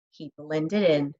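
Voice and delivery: usual speaking voice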